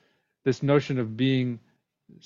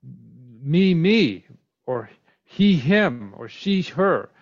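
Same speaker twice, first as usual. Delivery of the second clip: ME, ME, HE, HIM, SHE, HER punched louder, pitch swooping up on the second word; emphatic doubling; 4 dB louder, much slower than usual. Shown as a demonstration. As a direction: hit both words of ME ME, HE HIM, SHE HER hard. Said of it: "is an emphatic way of saying".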